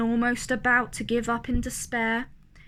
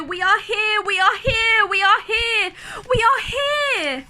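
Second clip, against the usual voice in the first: high, loud, desperate chorus cry; crying out in fear; 7 semitones higher, 4 dB louder than usual. A narrator's reading. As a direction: shout it out in terror, louder and louder. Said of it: "his people cried loudly, they cried out in fear".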